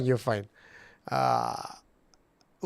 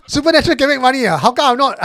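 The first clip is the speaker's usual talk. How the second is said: incredulous; 11 semitones higher, 9 dB louder, faster than usual. Retold or imitated. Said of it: "I will ask this".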